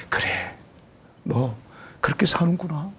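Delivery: soft, tender acceptance. Acted. God accepting a struggling believer, soft and tender. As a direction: say it as if forgiving a child.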